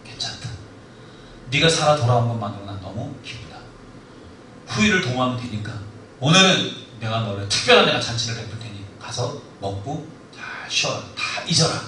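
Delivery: soft, low and kind, a king comforting his general; gentle royal comfort; consoling king. Retold or imitated. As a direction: reassure him like a forgiving ruler.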